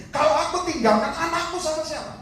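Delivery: high-pitched anxious question; acted worry. Voices a dying parent's worry.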